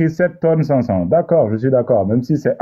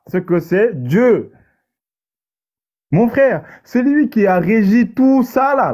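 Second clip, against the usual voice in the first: high, raised voice full of wonder, stretching the words; preacher lifting the audience in awe; awed preaching; 7 semitones higher than usual. Acted. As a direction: preach the vastness of creation, building. Preaches about God's immensity.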